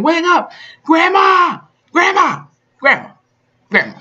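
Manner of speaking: desperate shouting. Retold or imitated. Boy finding his grandmother dead on the couch, high desperate yells. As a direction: yell for her like a panicked child.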